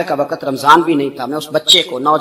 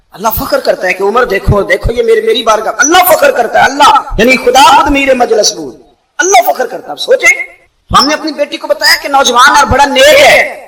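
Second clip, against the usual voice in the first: louder, higher preaching with laughter; fervent preaching; 6 semitones higher, 7 dB louder than usual. Acted. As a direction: preach it with rising joy. Preacher builds to fervent climax. (پرجوش خطابت)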